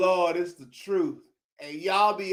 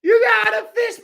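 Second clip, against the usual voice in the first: laughing; 5 dB louder, faster than usual. Real, not acted. Still laughing from previous line.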